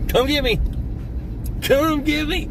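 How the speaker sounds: high, sing-song taunt; taunting dare. Retold or imitated. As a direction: dare them to come. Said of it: "I begged the dude".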